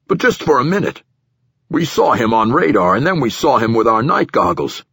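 official giving his account to a superior, a bit pushed; nervous platform director. Acted. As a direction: voice an uneasy director justifying himself.

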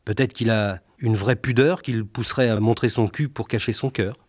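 ordinary talk

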